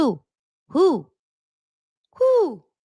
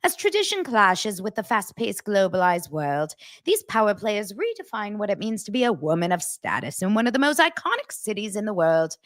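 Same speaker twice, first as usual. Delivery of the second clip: Dorit; 3 semitones higher than usual. Acted. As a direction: read it in a grand pseudo-British accent. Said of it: "That was Dorit reading it".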